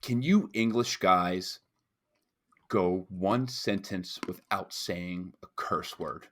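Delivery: slow, deliberate delivery; intimidating bouncer. Retold or imitated. Bouncer challenging drunk English guys, slow and intimidating. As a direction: space the words out, lightly imitating a stern bouncer.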